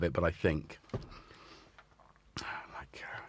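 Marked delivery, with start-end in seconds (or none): 2.4-3.2 hushed conspiratorial aside; whisper. Real, not acted. Host whispering about catching his colleague's cold. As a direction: drop to a whisper.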